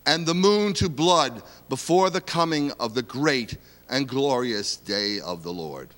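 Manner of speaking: ordinary delivery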